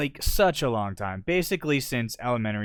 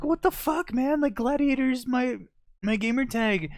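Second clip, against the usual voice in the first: indignant; 3 dB louder than usual. Retold or imitated. Retold outrage over renamed tag.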